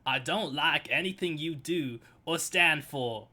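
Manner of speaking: broad hammed-up Aussie accent; performed Australian line reading; best Australian accent. Shown as a demonstration. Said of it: "in my best Australian".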